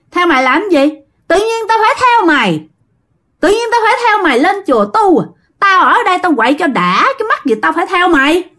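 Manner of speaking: high-pitched, indignant refusal, acted; mock-defiant possessed man, high and protesting; defiant retort. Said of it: "bắt đầu liếc ông thầy nói".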